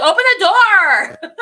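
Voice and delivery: high, loud yelled demand, laughing through it; imitating a shout to open the car door; shouted quote. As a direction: bark it out like she's locked out. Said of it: "She's like".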